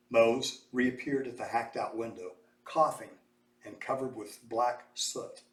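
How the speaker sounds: plain reading voice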